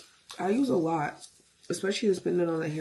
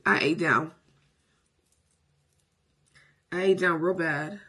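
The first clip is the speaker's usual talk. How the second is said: sassy drag queen; 5 dB louder than usual. Acted. Hyping her look.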